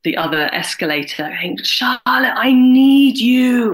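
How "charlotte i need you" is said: high, loud, slow mock yell of a name; retold scream across the shop. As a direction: call out desperately across the escalators. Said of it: "I got this scream across from".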